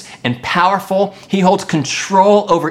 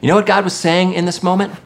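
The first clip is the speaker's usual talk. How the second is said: usual speaking voice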